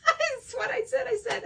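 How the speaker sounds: high, loud laughing outburst; real laughing yelp; laughing shout. Real, not acted. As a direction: burst out laughing mid-sentence. Laughing at her own story.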